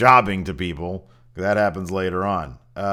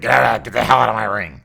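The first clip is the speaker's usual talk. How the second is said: louder mock heel boss ordering him out; hammed-up heel chairman, louder; heel boss Vince; 7 dB louder, faster than usual. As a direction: bark it like the evil boss owner. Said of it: "Vince comes out in full heel mode".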